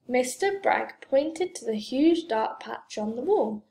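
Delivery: ordinary delivery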